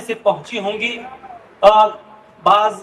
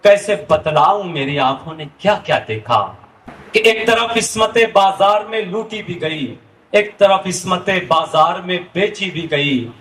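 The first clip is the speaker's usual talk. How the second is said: stately nazm recitation; 5 semitones lower than usual. Acted. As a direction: recite the poem with sorrowful weight. Nazm Sulagta Manzar recited.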